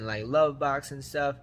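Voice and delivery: ordinary talk, nothing marked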